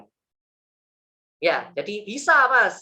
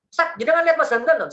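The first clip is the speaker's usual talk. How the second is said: much higher, loud urgent demand; demanding pursuer; 10 semitones higher, 6 dB louder than usual. Retold or imitated. The killer presses the ustaz about Hamdan, loud high demand.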